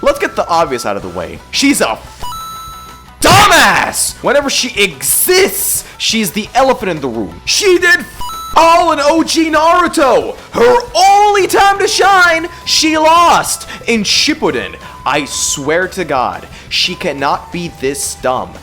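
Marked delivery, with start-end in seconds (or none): none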